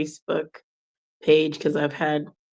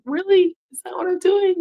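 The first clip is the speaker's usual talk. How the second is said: high, incredulous "really?"; playful fake surprise, pitched way up; mock disbelief; 4 dB louder than usual. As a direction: act shocked at being called out. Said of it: "I'm like".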